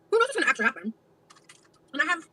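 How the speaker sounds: talking while chewing; words round a mouthful of food; mouth full. Real, not acted. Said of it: "Can you not chew?".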